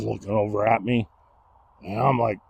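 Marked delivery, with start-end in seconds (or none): none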